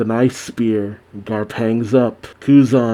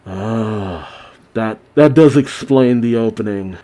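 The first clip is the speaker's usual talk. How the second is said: usual speaking voice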